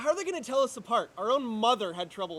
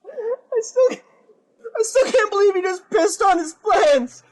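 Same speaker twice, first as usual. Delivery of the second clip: high, loud, breaking into helpless laughter; words squeezed out through uncontrollable laughter; laughing fit; 10 semitones higher, 13 dB louder, slower than usual. Acted. Albert cracking up in jail, loud laughing through words. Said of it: "Albert can barely contain himself as his fear turns to hilarity".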